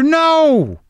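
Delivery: high, drawn-out "no"; exasperated high cry of no; emphatic shouted no. Acted. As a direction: wail it out as a blunt denial. Acted answer to anxious question.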